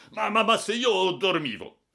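high, loud stammering objection; spluttering protest. A narrator's reading. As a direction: stammer in indignant disbelief. Flustered passenger protests the fine.

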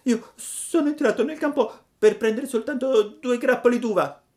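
put-on high, puppet-boy voice, pleading; a wooden boy begging for help, pitched high; high child voice begging the firefly; Pinocchio pleading. A narrator's reading.

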